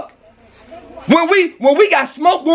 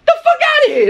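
much higher mocking laugh; laughing scoff; 12 semitones higher than usual. Real, not acted. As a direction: burst out laughing at the absurdity. Scoffing at neighbor's claim.

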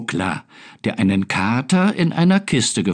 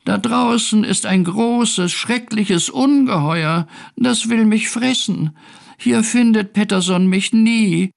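high-pitched, scared little cat; acted tearful fear, pitched high; sobbing; 9 semitones higher than usual. A narrator's reading. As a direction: wail it like a frightened kitten. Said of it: "schluchzte Findus".

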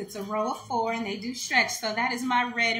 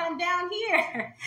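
put-on slow, much higher little-girl reply; 9 semitones higher, much slower than usual. Small girl answers mom from by dad's leg, high and slow. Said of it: "And I said".

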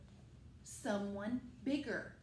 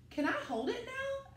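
put-on much higher, eager child voice; a small boy calling up hopefully; little Sam's voice; 11 semitones higher than usual. Sam asks the people.

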